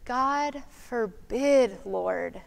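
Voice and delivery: slow, soft, trembling near-whisper; almost whisper it in fear; fearful near-whisper. Shown as a demonstration. Preacher imagining Peter afraid, almost whispering. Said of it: "he almost whispered".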